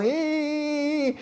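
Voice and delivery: sudden high-pitched mock cry of a pleading woman; imitated shrill cry. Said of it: "Tienes a la mujer ahí gritando".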